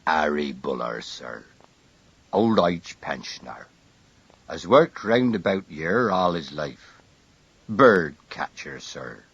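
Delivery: low, stiff constable report; wooden constable. A narrator's reading. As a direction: report like a soldier on parade.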